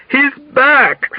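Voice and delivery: high, urgent shout; shouted alarm. A narrator's reading. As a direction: shout the alarm across a ward. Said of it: "someone shouted at him".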